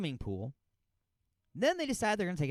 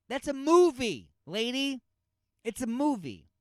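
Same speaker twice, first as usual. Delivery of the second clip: exasperated shout; 7 semitones higher, 5 dB louder than usual. Real, not acted. Scolding the mother.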